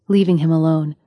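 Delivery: ordinary delivery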